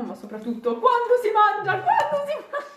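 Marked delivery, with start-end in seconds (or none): 0.8-1.8 excited. Real, not acted. Comic punchline after mock questions. 1.8-2.7 laughing. Real, not acted. Repeated punchline, laughing.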